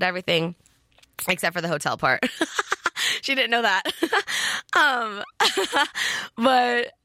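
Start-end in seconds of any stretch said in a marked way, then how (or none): 2.3-3.2 airy embarrassed giggle; quick sheepish unvoiced laugh; breathy giggle. Real, not acted. Laughs recalling hotel secret. 3.9-4.7 airy embarrassed giggle; quick sheepish unvoiced laugh; breathy giggle. Real, not acted. Laughs recalling hotel secret. 5.3-6.3 a laugh that cracks toward tears; half laugh, half sob, a little emotional; tearful laugh; laughing cry. Real, not acted.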